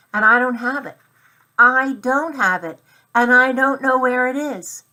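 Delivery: put-on upset boy; anxious. A narrator's reading. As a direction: fret about the lost watch. Boy panics about missing watch.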